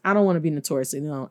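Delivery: ordinary talk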